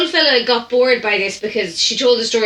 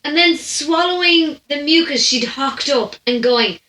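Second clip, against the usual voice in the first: normal talking voice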